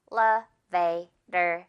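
"Elevator" in four slow, separate chunks; syllable by syllable. Shown as a demonstration. Teacher shows the four syllables of Elevator, slowly, syllable by syllable. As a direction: break "Elevator" into four evenly spaced syllables. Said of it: "네 덩어리로 구성되어 있어요".

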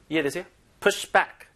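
ordinary talk

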